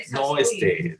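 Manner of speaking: ordinary delivery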